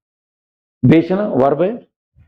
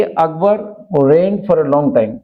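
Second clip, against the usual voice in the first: very slow, word by word; slow classroom dictation; much slower than usual. Shown as a demonstration. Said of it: "तो देखिए".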